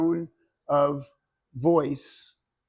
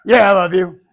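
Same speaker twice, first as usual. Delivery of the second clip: much louder, tossed off, "I love you" with a careless shout; loud flippant tone; 3 semitones higher, 13 dB louder than usual. Shown as a demonstration. Teacher contrasts tones for students, loud and careless. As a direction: bark "Yeah, I love you" dismissively. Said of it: "Or it can be".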